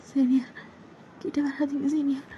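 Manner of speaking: put-on frightened girl whispering. Junior whispering to her senior to keep away, frightened. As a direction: beg him to stay away, barely voiced. Girl begging him back.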